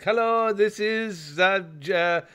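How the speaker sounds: mimicking a scam caller with an Indian accent. Tells co-hosts about a scam call, mock Indian accent. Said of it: "very clearly a Pakistani Indian call center".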